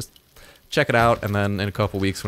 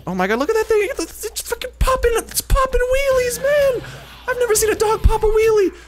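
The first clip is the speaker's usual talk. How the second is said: squealing, much higher and louder in amazement; excited disbelief; 4 dB louder than usual. Real, not acted. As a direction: shriek it out like you can't believe your eyes. Dog popping wheelies in game.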